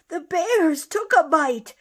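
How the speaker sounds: high and loud, alarmed shout; young hare crying out the news; excited cry. Acted. Youngest hare alarmed at bears, shouting high and loud. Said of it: "the youngest cried".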